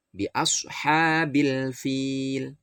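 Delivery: bi ashabil fil slowly, the l of ashabil said clearly; clear lam. Shown as a demonstration. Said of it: "tapi bil". (bi ashabil fil, lam dibaca jelas)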